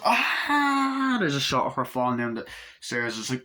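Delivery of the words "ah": high, drawn-out cry; high retold shriek of pain; mock scream. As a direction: imitate screaming as you are stabbed. Character is stabbed.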